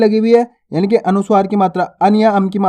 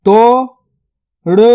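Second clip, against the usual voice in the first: word split into two spaced syllables. Shown as a demonstration. Teacher sounding out a word for learners, syllable by syllable. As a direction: sound out each syllable separately, long gap between.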